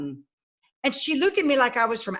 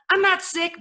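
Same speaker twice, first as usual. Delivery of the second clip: retold objection, pitched high; indignant protest; 8 semitones higher than usual. Player told she's cut from the team, retold indignant protest. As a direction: push back in a higher voice.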